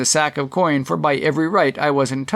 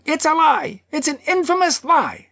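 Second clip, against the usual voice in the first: high-pitched cry of accusation; furious character shout, pitched right up; outraged shouting. A narrator's reading. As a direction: shout it in high, indignant protest. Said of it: "Billson was on his feet and shouting".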